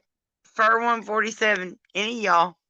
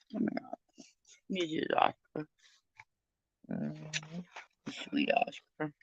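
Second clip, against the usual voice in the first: tender; 12 dB quieter than usual. Real, not acted. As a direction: coo at the dog. Talking to her dog.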